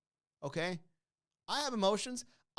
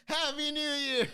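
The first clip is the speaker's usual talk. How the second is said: mock New Year cheer; 13 semitones higher, 4 dB louder, slower than usual. Countdown finale cheer.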